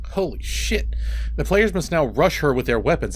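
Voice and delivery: usual speaking voice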